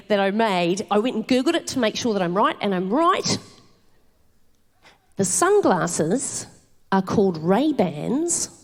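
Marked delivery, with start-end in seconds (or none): 5.2-8.5 very slow, drawn-out awed reveal; awed revelation. Acted. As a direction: spell out the discovery slowly, as if it's profound. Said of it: "I blew my own mind".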